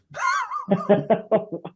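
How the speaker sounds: a real, high-pitched burst of laughter. Real, not acted. Guest laughing at his own boot camp story, high laugh.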